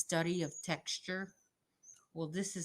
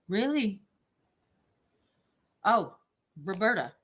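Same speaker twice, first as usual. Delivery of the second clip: surprised; 4 semitones higher, 6 dB louder than usual. Real, not acted. Reacting to a viewer comment.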